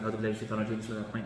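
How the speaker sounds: mumbled quickly and low, by habit; rushed rote recitation. Retold or imitated. Teacher mimicking a habitual, thoughtless start to prayer, rushed rote recitation. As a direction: rattle it off softly without any meaning. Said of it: "what did you just say".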